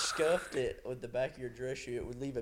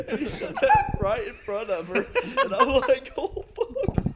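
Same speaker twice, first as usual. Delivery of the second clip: high, loud, laughing narration; can barely get it out laughing; 11 dB louder than usual. Real, not acted. Cracking up over the memory.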